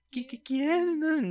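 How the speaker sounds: high, drawn-out stammering question; mock scared mother. Mother asks who is at the door, mock frightened. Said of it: "así toda asustada".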